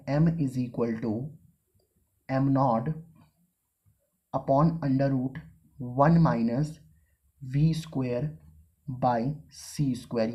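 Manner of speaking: very slow, one term at a time; teacher dictating the formula. Shown as a demonstration. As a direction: spell the equation out for note-taking. Dictating the equation.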